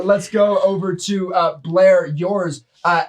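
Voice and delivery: ordinary talk, nothing marked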